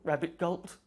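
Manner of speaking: ordinary delivery, nothing marked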